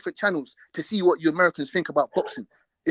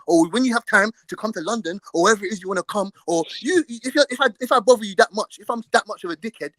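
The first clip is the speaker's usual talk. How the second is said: ordinary talk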